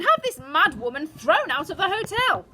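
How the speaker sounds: high, loud affronted order; outraged wife demanding action; indignant demand. A narrator's reading. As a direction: insist on it forcefully. Mother demanding grandmother's removal.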